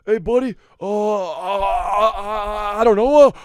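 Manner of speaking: high, loud flustered stammer; stammering impression. Comic doing a flustered actor, high stammering voice.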